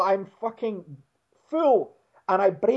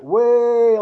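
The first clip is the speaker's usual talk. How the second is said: loud, higher, long-held lads' cheer; mock jeering cheer; 5 semitones higher, 7 dB louder than usual. Guys on the street jeer his fall, mock cheer. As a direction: imitate a crowd's drawn-out jeer.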